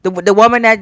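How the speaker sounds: normal talking voice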